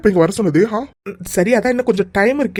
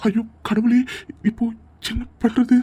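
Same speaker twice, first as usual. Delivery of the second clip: usual speaking voice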